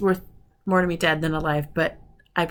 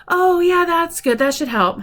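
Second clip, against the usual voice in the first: cheerful; 10 semitones higher, 6 dB louder, slower than usual. Retold or imitated. Doctor's cheerful reply.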